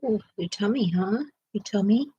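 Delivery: soft pet talk. Real, not acted. Fussing over the whining dog.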